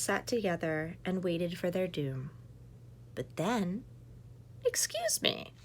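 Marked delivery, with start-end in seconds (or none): 4.6-5.5 very high polite voice; mystery voice. Acted. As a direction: voice from the dark cave. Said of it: "said a voice".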